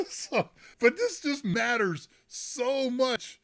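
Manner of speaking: usual speaking voice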